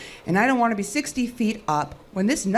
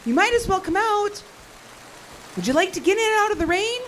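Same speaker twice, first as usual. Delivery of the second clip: calling out; 11 semitones higher than usual. Acted. Calling out to hidden figure.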